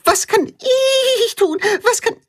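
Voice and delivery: very high, pleading puppy; begging. A narrator's reading. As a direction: beg eagerly, nearly squealing. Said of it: "bettelt Tacho".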